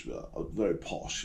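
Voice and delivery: put-on posh British accent, low and very soft; hushed mock-posh murmur. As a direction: imitate a posh royal accent, quietly. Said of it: "They speak like that".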